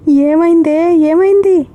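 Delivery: high-pitched alarmed questioning; worried urgent call, acted by a storyteller; loud, anxious. A narrator's reading. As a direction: demand what happened, pitch climbing in worry. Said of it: "గట్టిగా ఆందోళనగా అడిగింది".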